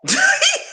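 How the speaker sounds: high burst of laughter. Real, not acted. Laughing at a chat comment.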